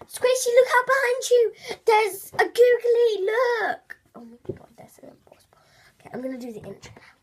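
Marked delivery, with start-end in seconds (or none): 0.1-4.0 very high, loud squeal; hammed-up high-pitched gamer voice; squealing YouTuber impression. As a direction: shout a warning in a cartoonish high voice. Impersonating YouTuber's catchphrase.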